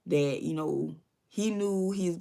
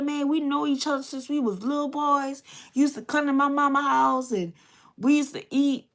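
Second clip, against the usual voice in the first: higher and louder, urgent pleading; 5 semitones higher, 4 dB louder than usual. Retold or imitated. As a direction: beg your childhood friend to stand down. Pleading with armed friend.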